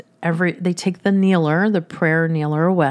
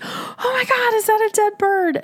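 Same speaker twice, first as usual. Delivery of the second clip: much higher, shocked cry of a startled mom; retold horrified shriek. Mom shrieking at her daughter holding a dead bird, horrified high cry. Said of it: "she screamed".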